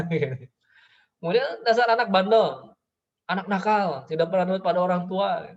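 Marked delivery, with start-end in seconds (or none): 1.6-5.5 higher, louder mock telling-off; scolding father. Father scolding a naughty child, raised mock-angry voice. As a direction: imitate an angry parent berating a child. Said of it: "pasti dimarahin begini".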